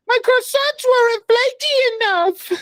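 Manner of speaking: very high, slow mock French; bad French accent. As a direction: squeal it slowly in a terrible French accent. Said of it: "That's not French accent".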